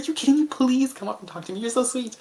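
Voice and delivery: high happy disbelief; thrilled. Retold or imitated. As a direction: burst out delighted. Excited reply to a compliment.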